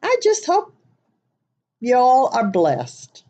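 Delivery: high, drawn-out Southern blessing; hammed-up Southern accent. Shown as a demonstration. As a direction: lay the Southern accent on thick and slow. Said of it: "Hope you enjoy my Southern accent".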